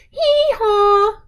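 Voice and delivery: high hee-haw donkey bray; playful mock donkey call. Shown as a demonstration. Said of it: "can you say".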